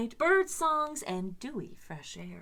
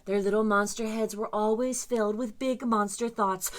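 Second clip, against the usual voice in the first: plain reading voice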